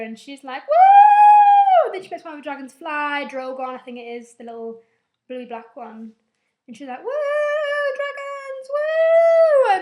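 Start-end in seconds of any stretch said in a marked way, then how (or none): 0.7-1.9 very high, loud whoop; long piercing mock cheer. Imitates Dany cheering in crowd. 7.0-9.8 very high, hammed-up whooping; mock gleeful cheer. Imitates Dany cheering her dragons.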